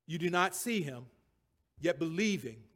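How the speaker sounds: ordinary talk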